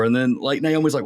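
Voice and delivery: ordinary talk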